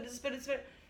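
ordinary delivery

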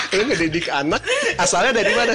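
loud, high, laughing throughout; mocking through laughter. Real, not acted. Mocking parenting from Instagram.

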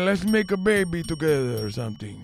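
put-on old guy. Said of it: "that old guy".

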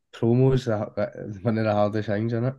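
usual speaking voice

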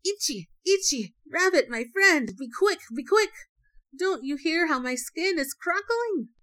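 high, urgent call for help; loud calling. A narrator's reading. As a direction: call out loudly and hurry your friend along. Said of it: "Then he called loudly".

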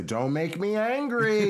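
loud, slow, high threat; mom's warning. Acted. Imitating mom warning the kids, slow high holler. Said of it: "You would always say".

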